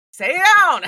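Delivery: loud, high yelled command; mock scream. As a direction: yell it as a retold scream. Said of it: "both Des and him scream".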